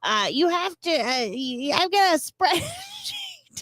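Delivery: put-on high, wavering old lady. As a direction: play a sweet old granny luring them in. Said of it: "You have to do it in an old lady voice".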